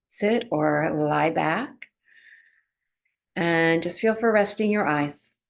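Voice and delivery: calm, unhurried meditation voice with long pauses; guided relaxation. Real, not acted. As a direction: ease them gently into breath awareness, phrase by phrase. Guides breath awareness and body relaxation.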